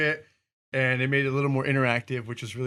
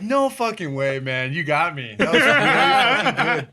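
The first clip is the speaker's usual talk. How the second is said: laughing disbelief; 6 semitones higher, 4 dB louder than usual. Retold or imitated. Retold reaction, laughing.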